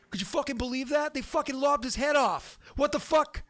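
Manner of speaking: loud, high mock Boston outrage; obnoxious Southie. Game master demos Bostonian Cthulhu campaign, mock-outraged Southie shout. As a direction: shout it like a scandalized Bostonian, hammed up. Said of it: "straight up obnoxious Southie".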